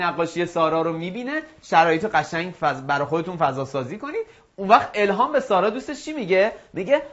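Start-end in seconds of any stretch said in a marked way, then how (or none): none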